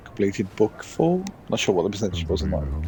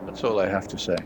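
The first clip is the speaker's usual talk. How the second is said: normal talking voice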